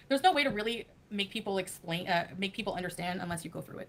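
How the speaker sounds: ordinary talk, nothing marked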